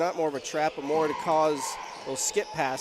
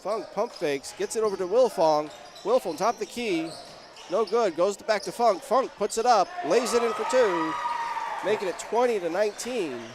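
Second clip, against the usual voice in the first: excited call, raised pitch and level; live play-by-play; 6 semitones higher, 6 dB louder than usual. Real, not acted. Announcer calls Funk laying it in, excited live play-by-play. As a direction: build with the play to the basket.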